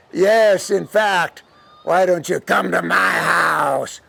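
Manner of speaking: slow luring voice building to a shout; El Diablo character. Acted. Devil luring the girl home, slow then shouted. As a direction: stretch it out into a looming invitation.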